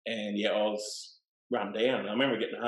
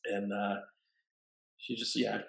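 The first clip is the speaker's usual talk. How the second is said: ordinary delivery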